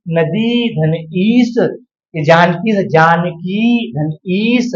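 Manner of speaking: very slow, word by word; patient drawn-out breakdown; slow dictation. Shown as a demonstration. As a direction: lay out each piece slowly. Teacher slowly splitting a sandhi.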